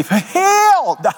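high, loud, drawn-out holy-roller cry; hammed-up revival shout; mock Pentecostal fervor. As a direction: stretch the word into a comic, held wail. Mocks Aunt Goosey's Pentecostal boast.

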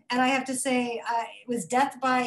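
normal talking voice

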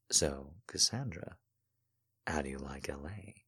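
hushed, intimate whisper; soft whisper. Acted. Stephen whispering flirtatiously to Cassandra, hushed. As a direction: breathe the question low and close.

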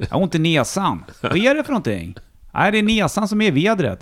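high-pitched mock Stockholm accent. Shown as a demonstration. Showing off the neighbours' Stockholm dialect, exaggerated high Stockholm accent. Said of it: "jag kan ju prata stockholmska".

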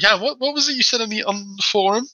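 high-pitched mock-innocent questioning; retold teasing. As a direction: needle him with a feigned innocent question. Teasing Jan about his forum boast.

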